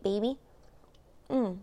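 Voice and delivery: usual speaking voice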